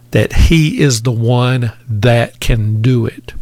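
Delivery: normal talking voice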